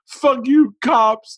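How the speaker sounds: high, loud mock-tearful outburst; freaking out. Imitating Verbal cursing the cops as he leaves, high and upset. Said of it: "He's freaking out".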